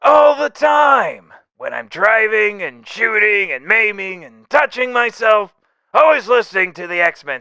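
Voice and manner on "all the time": high, loud, slow gushing shout; over-the-top eager cry. Acted. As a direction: burst out like a superfan. Eager fan insists.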